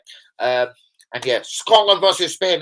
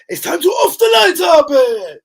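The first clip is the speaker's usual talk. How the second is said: much higher, loud put-on Scottish accent shout; hammed-up Scottish accent; 10 semitones higher, 8 dB louder than usual. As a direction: holler it in a mock Scottish accent. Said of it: "hear better the Scottish accent".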